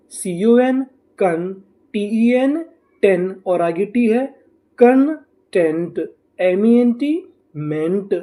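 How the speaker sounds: slow, syllable by syllable, each code then its sound. Shown as a demonstration. Teacher to students, sounding out each code slowly. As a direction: spell it out slowly for the class. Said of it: "तो हमारी meaning क्या बनी?".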